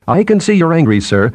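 lightly voiced nervous chatterer; nervous chattering. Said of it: "Binet chattering again".

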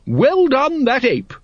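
high, ringing ladylike praise; hearty grand lady. Acted. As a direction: cheer the ape on in a soaring voice. Lady Ramkin hails the ape.

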